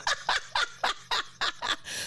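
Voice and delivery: high breathless laughing fit; wheezing laughter; real laugh. Real, not acted. Host laughing at a viewer's joke, breathless.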